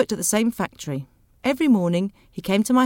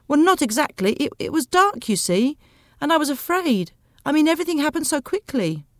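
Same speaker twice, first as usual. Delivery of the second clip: higher-pitched, defensive excuse to the police; pleading, higher-voiced account; flustered explaining; 7 semitones higher than usual. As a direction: explain yourself anxiously to an officer. Narrator nervously explains to the police.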